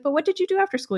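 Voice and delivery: high, bright put-on curiosity; mock chirpy question. Buffy asking the vamp about school, high and chirpy. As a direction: ask it like casual small talk. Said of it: "She's like".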